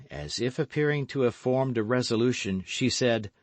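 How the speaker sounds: plain reading voice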